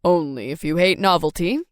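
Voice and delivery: joking dad. A narrator's reading. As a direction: tease it like a fond dad. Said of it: "he joked".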